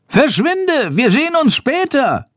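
high, urgent bat cry; calling out. A narrator's reading. Bat sends the boy off while circling the farmer, calling. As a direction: call it out in a very high squeak. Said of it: "ruft Schandor".

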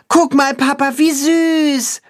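put-on a boy calls to his father about the calf; child calling out. A narrator's reading. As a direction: call out to Papa with glee. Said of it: "ruft der Junge".

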